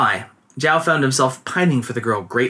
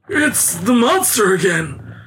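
slow, higher, frightened shout; terrified cry; 6 semitones higher, slower than usual. A narrator's reading. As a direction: cry out in terror. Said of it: "cried Old An in terror".

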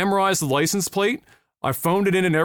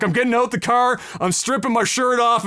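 ordinary talk, nothing marked

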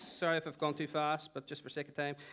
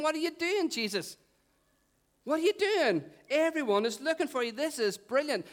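exasperated disciples; 8 semitones higher than usual. Acted. Disciples confronting Jesus while everyone is looking for him, exasperated. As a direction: plead excitedly, pitched high throughout.

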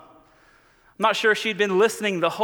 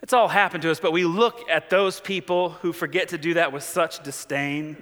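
ordinary talk

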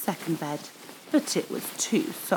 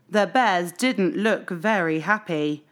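ordinary delivery, nothing marked

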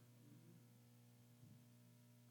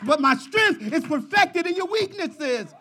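preaching at full force; 9 semitones higher than usual. Acted. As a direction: urge them to cry out to God. Preacher's climax.

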